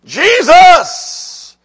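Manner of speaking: high, full-force shout; crying out. Acted. Preacher crying out like Bartimaeus to Jesus, loud desperate shout. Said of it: "Cry out like Bartimaeus cried out".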